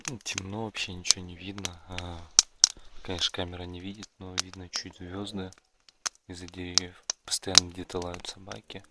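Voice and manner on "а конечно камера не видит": normal talking voice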